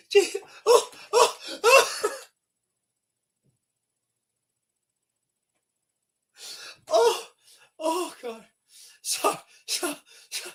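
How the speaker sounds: high, breathless gasps between laughs; wheezed-out moans, unable to recover; real helpless laughter. Real, not acted. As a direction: struggle for words through tearful laughter. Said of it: "I shouldn't be laughing".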